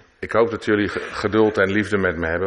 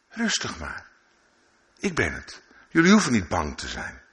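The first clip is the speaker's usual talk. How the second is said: calm reassurance. Acted. Jesus calms frightened disciples.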